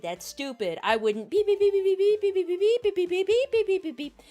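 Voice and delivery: imitated objection climbing into high, loud babble; mock protest building to squeaky blather; mocking refusal. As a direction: start dismissive, then rattle off high-pitched nonsense noises. Mimicking sister's months of refusal.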